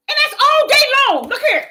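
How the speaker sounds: high, loud exclamation; 1 exclaimed. Real, not acted. Preacher exclaiming to the church how often it happened. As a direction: fling it up high.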